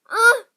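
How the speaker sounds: sharp high strangled whimper cry; retold whimper. A child paralysed in bed whimpers for help. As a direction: squeeze out a sudden frightened yelp. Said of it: "I got a little whimper out".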